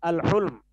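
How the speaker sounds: الحلم said "al-hulm", with a short u; u after the H. Shown as a demonstration. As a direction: say الحلم with damma on the ح. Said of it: "ہا کے ضمے کے ساتھ". (الحلم کو حا کے پیش سے "اَلحُلم" پڑھیں)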